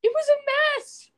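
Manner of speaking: very high, drawn-out exasperation; high-pitched protest. Real, not acted. Host insists to co-host the dress was bad, squealed protest. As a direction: squeal it slowly in comic indignation.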